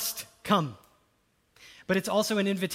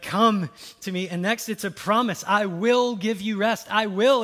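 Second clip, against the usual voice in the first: emphatic promise; 2 semitones higher, 8 dB louder than usual. Acted. Quoting Jesus emphatically.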